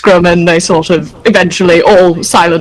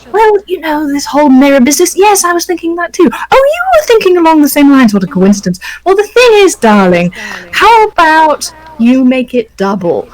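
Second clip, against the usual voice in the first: higher, chummy banter; chummy clones; 7 semitones higher than usual. Acted. Lightly voiced clones chatting smugly among themselves. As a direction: trade smug remarks. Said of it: "sort of discussing with it, each other".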